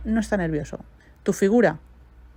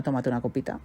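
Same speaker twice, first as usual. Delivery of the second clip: soft inner voice; 5 semitones lower, 8 dB quieter than usual. Retold or imitated. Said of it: "su cerebro relaciona".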